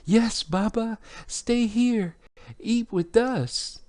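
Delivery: put-on very high-pitched pleading kids; children's voices. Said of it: "the children are like".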